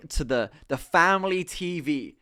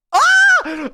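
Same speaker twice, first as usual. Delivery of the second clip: sudden high scream of horror; real panicked shriek; shocked scream; 14 dB louder than usual. Real, not acted. Shocked at clip on screen.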